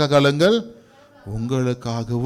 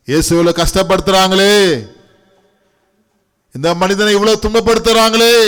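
lament of the women; 4 semitones higher, 5 dB louder than usual. Acted.

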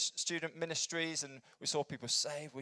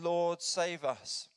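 slow, raised cry to God; 5 semitones higher, 5 dB louder, much slower than usual. Retold or imitated. Festival crowd prays to God, crying out. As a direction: call out higher and louder, drawn out. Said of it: "crying out to God".

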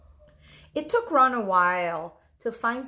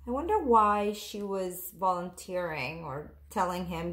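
ordinary delivery, nothing marked

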